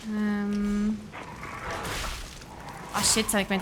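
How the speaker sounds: ordinary delivery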